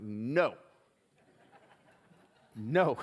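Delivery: loud, emphatic retold no; blunt refusal. Man refusing God's prompt to forgive, loud and emphatic. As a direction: act out a firm refusal, punched out loud.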